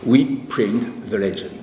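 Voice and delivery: English line in a strong French accent. Real, not acted. Lecturer quotes the film's famous English line, French-accented English. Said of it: "excusez mon accent anglais qui est épouvantable".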